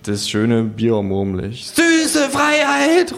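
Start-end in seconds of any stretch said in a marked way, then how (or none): 1.7-3.1 high, slow, loud call; slow exultant cry. Acted. Drunk kangaroo exulting over the ruined phone. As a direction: stretch it out in drunken triumph. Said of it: "ruft das Känguru".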